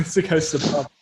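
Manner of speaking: ordinary talk, nothing marked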